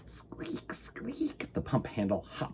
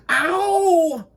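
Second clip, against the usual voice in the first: long, high, loud "ow"; drawn-out high yowl; howl of pain; 9 dB louder than usual. A narrator's reading. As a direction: wail it out as if tripping. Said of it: "howled Mister Winky when he tripped".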